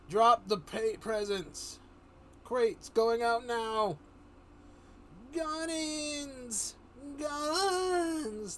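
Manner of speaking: high, loud radio call, slowed on crates; helicopter crewman. Acted. Crewman calling the drop, high and loud. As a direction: bark it over the rotor noise.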